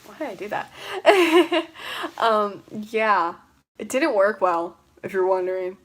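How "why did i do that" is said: laughing through pain. Real, not acted. Hair magnet hurts, laughing.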